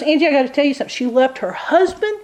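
lightly voiced lawyer telling the shocking part; lawyer confiding. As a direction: reveal the scandal bit by bit. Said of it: "She goes".

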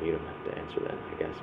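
hushed aside to the room; soft unvoiced afterthought; whispered aside. Real, not acted. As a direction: drop to a whisper, just between us. Aside after rhetorical question.

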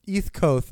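"East Coast" dicho "iθ kʰoʊθ", ceceando; lisped East Coast. Shown as a demonstration. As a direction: say "East Coast" lisping both s sounds. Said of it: "sounds like someone with a lisp".

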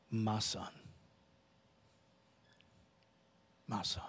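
very soft, tender call of a son's name; tender father. Retold or imitated. Father answering his hurt son, soft and tender. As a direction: say it gently, as a loving father would. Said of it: "hear the tone here".

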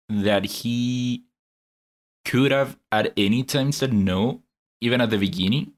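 usual speaking voice

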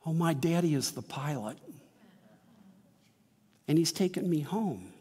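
put-on very quiet little girl. Little girl quietly answers the scared woman on the plane. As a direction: say it softly, perfectly content. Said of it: "says very quietly".

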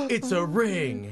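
slow reveal. Pretend.

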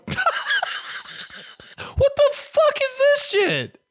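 high, cracking laughing squeal; laughing disbelief. Retold or imitated. Teen staring at a tax form, laughing in high disbelief. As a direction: squeak it out through laughter, baffled. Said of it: "I'm like".

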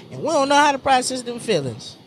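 usual speaking voice

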